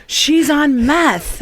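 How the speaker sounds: high, loud, very drawn-out mock yell; exasperated teacher. Acting teacher correcting how she played the meth user, exasperated yell.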